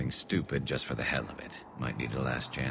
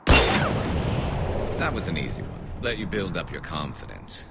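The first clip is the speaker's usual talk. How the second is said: cocky boast; 3 semitones higher, 8 dB louder than usual. Acted. Garrus boasting to Shepard after a shot, loud cocky brag. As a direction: crow over the easy shot. Bottle-shooting contest boast.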